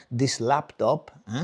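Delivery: put-on slow Spanish-accented English; Spanish father's accent. Spanish father phoning son about laptop, slow accented complaint. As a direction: imitate a Spanish dad, drawing out the words. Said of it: "He goes".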